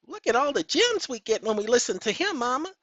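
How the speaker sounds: put-on high kid voice praising to mama; high childish voice. A child telling Mama about the gems in the videos, in a high squeaky voice. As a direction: chirp it high like an excited child.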